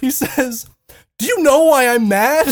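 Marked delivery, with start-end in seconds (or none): 1.2-2.5 loud, higher mock-furious demand; hammed-up shouted question; retold angry outburst. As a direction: yell it as an imitation of a seething friend. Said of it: "and he's so angry".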